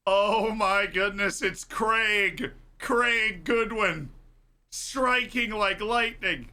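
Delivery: slow, drawn-out amazed commentary; awed play-by-play, stretched out; excited goal call. Real, not acted. Calling the goal scorer.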